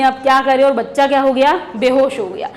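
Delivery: normal talking voice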